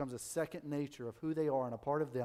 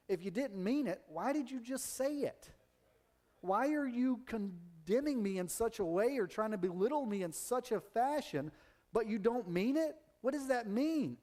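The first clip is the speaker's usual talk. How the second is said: higher-pitched, incredulous challenge; indignant retort; 7 semitones higher than usual. Acted. Hurt person confronting someone who was only joking, indignant retort. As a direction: demand an explanation, pitch climbing on each question. Acted retort to a sarcastic joker.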